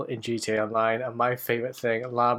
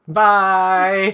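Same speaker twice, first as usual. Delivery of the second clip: much louder, higher goodbye; loud cheery bye; called out bye; 7 semitones higher, 9 dB louder than usual. Real, not acted. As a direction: sing out the farewell. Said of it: "I've not hit the bye bit yet".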